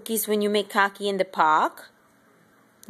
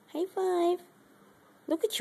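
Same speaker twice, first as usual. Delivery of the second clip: "High five" much higher and softer, sing-song; squeaky pet voice; 8 semitones higher, 4 dB quieter than usual. Real, not acted.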